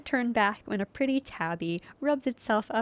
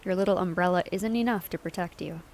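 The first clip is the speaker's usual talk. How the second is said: normal talking voice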